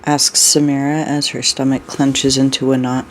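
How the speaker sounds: ordinary delivery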